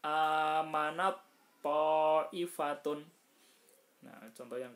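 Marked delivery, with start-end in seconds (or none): 0.0-3.1 "amanat poifatun" chanted slow and loud, the t melting into a doubled heavy t; "amanat poifatun" as slow tajwid recitation, first t absorbed; merged ta into to. Shown as a demonstration. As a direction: recite "amanat poifatun" with drawn-out vowels, loudly. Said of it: "toknya ditasdidkan".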